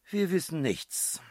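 high, slow, loud confession of defeat; chorused admission. A narrator's reading. Both puzzlers admitting they know nothing, high and slow. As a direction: draw it out in a high, helpless singsong.

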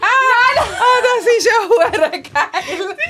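high, loud screams of real surprise, breaking into laughter; surprised screaming. Real, not acted. Host surprised by guest's arrival. (überraschtes Kreischen, laut und hoch mit Lachen)

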